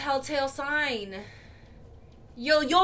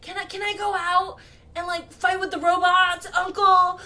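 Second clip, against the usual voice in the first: higher, louder mock boyish plea; pleading nephew; 6 semitones higher, 5 dB louder than usual. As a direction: imitate a kid begging his uncle. Voices movie character whining to uncle.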